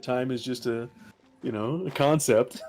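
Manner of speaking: normal talking voice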